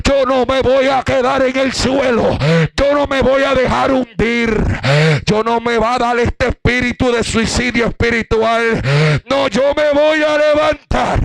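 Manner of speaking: high-pitched, mounting preacher's declaration; defiant vow. Retold or imitated. Preacher reliving his refusal to sink, defiant vow. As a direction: build in pitch to a climactic refusal to stay down.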